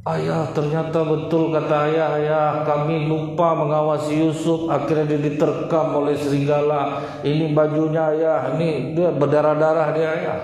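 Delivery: hammed-up fake grief, pitched high; fake grieving sons. Brothers faking grief to their father Yakub, mock wailing. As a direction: play the lying brothers putting on a sad show. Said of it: "Acting saudara Nabi Yusuf".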